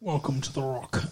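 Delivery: put-on low, soft Scottish-accented movie line; Sean Connery. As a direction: murmur it as the Scottish actor. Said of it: "Sean Connery does that accent in every".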